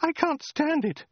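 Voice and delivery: very high falsetto outcry; crying-out woman. A narrator's reading. Woman collapsing on the floor, high desperate cry. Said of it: "cried the woman".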